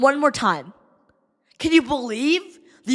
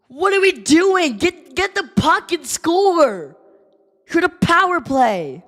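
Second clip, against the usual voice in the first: frustrated yelling; 7 semitones higher than usual. Real, not acted. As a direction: demand it from the players. Fan yelling at team.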